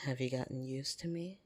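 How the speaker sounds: ordinary talk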